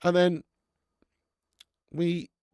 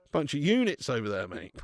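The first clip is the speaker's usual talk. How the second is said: usual speaking voice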